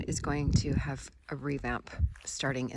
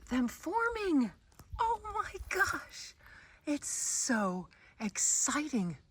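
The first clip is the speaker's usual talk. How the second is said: much higher and slow, gushing; beyond excited; 10 semitones higher, much slower than usual. Real, not acted. Gardener gushing over courgettes forming, high slow delight. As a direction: let the delight spill out slowly. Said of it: "I'm just beyond excited right now".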